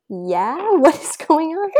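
high, swooping questioning tone; acted-out wary reply. Husband answering her casual text, puzzled and high.

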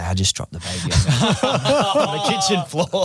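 high, laughing through the words; laughing confession. Retold or imitated. Dad admitting to the mums he dropped the baby, laughing. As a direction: giggle out the embarrassing confession.

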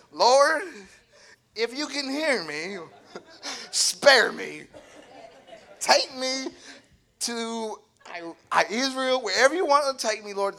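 begging God to whisk him away, hammed up; mock frantic prayer. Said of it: "You're like".

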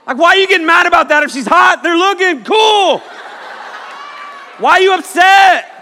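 high, loud comic shouting; hammed-up exasperation. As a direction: yell in mock disbelief at jealous boyfriends. Mock scolding of jealous men.

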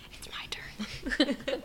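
slow whisper. Real, not acted. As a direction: whisper it slowly to yourself. Whispered aside.

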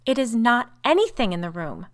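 high, loud, horrified denial; obstinate horror. A narrator's reading. Sister rejects any cause in the room, obstinately horrified. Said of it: "repeated Rebecca with obstinate horror".

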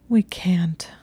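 mild whispered whimper. A narrator's reading. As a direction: whimper it under your breath, scared of being caught. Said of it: "she whimpered".

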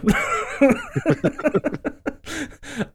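high squealing laugh; high burst of laughter. Real, not acted. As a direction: laugh hard in disbelief. Laughing at ripped jeans.

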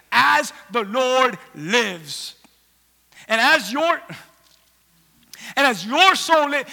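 slow, weighty, word by word; determined vow, each word stressed; emphatic oath. Acted. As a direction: swear it with forceful deliberation. Acting mother's solemn defiant oath.

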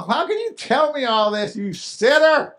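mocking relative. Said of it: "they're gonna go".

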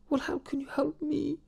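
breathy high whispered question. Acted. Character whispers a doubtful question to a stranger.

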